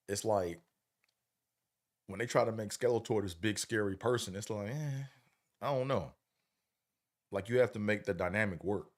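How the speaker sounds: usual speaking voice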